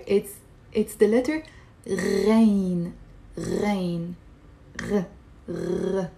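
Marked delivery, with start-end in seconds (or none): 1.9-4.1 slow, separated "Ghain" as a pronunciation model; throaty Ghain. Shown as a demonstration. Teacher showing learners the Arabic letter, "Ghain" from the throat. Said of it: "how to pronounce it". 4.8-6.1 "R" as a gargled rasp from the throat; throaty ghain sound. Shown as a demonstration. Teacher modelling the letter's sound, throaty gargled "R". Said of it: "how to pronounce it".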